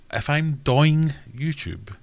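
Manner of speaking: doing stretched slowly into a high, loud DOY-ING; mock DOY-ING. Mocking the YouTuber's DOY-ING, high and slow. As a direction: drag out DOY-ING in mockery. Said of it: "And I'm going".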